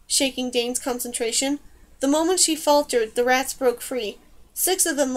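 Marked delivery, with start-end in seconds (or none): none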